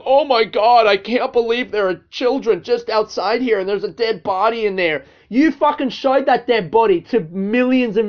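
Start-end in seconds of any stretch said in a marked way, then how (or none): 0.1-5.0 higher, louder hammed-up mock disbelief; mocking the YouTuber. Quoting the YouTuber's video to listeners, hammed-up mock shock. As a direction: parody his fake shock. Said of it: "at the end of the video was going".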